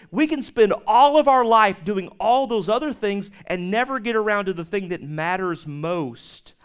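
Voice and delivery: ordinary talk, nothing marked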